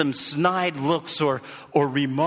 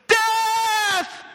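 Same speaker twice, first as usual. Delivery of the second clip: very high, loud war shout; rally the cavalry with one cry; battle cry; 5 dB louder than usual. Retold or imitated. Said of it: "he leads the chant cry".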